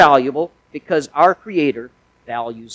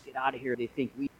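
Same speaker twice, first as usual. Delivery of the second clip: hushed, much softer aside; retold whisper, voice barely raised; imitating a whisper; 4 semitones lower, 17 dB quieter, faster than usual. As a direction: drop it low, like whispering to the boy beside you. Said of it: "one whispers to the other".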